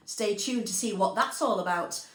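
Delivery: ordinary delivery, nothing marked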